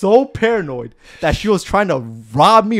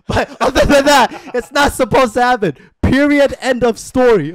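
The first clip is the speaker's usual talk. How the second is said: emphatic insistence; 5 semitones higher, 4 dB louder than usual. Real, not acted. Insisting it never happens.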